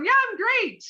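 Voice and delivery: hammed-up everything's-fine voice; fake-cheery reply. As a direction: parody a brave-face answer. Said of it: "So we go from".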